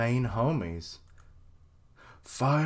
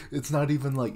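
usual speaking voice

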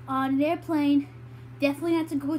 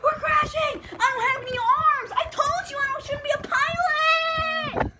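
high, loud shrieking panic; panicked screaming; 13 semitones higher, 7 dB louder than usual. Pretend. Pilot screaming to the passengers during the crash. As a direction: shriek it like you're going down.

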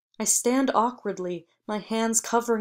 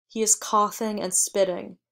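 plain reading voice

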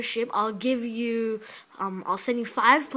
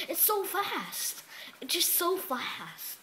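high, slow, stretched-out amazement; drawn-out marvelling; 7 semitones higher, 4 dB quieter, much slower than usual. Real, not acted. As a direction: draw out FAST in high-pitched wonder. Marvelling at the computer's speed.

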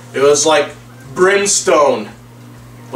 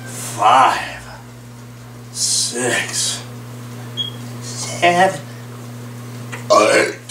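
strained counting during push ups; doing push ups; 7 semitones lower, 7 dB quieter, much slower than usual. Real, not acted. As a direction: count reps, running out of breath. Counting reps while exercising.

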